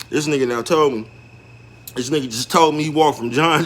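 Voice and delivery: ordinary delivery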